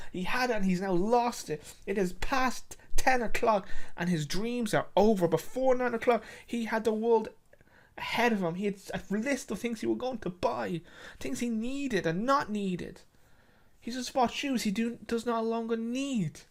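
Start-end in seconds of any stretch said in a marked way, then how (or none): none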